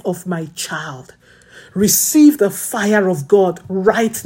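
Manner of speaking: slow, emphatic intercession; fervent prayer. Acted. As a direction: lay each need before God.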